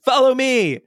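high-pitched, hammed-up story character; high character voice for reading aloud; Danny's character voice. A narrator's reading. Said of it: "Danny has started talking like that".

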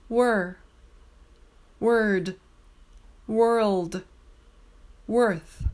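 Were, word, world, worth each said clearly with the r-vowel; careful r-vowel. Shown as a demonstration. Teacher modelling were, word, world, worth. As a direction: model the ER sounds one by one.

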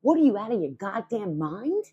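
usual speaking voice

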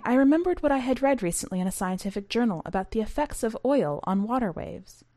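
ordinary delivery

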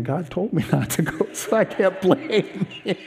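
rueful words through laughter; laughing at himself. Real, not acted. Admitting he ignored God's warning, laughing at himself. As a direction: chuckle through the admission.

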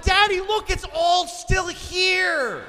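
put-on high, loud, drawn-out kid yell; shrieking mock-child wonder; excited child. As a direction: shout it like a kid amazed to be alive. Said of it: "they wanna tell me they're alive".

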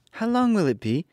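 put-on high little-girl voice, asking; a young girl wondering aloud; small girl asking how long; Dorothy, a child. A narrator's reading. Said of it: "the child asked".

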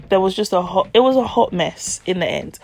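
ordinary delivery

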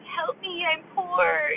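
mock crying, high and pleading; whiny Bridesmaids character. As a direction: whimper it like a drugged-up sad comic heroine. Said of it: "but she's like".

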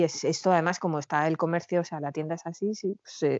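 usual speaking voice

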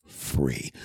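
breathy menacing whisper of "free". Acted. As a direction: drop to a sly whisper. Whispered threat.

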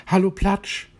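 high, loud delighted hello; happy greeting. A narrator's reading. Plitsch spots his friend and calls out happily, high and loud. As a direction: glad to find his friend. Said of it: "freute er sich".

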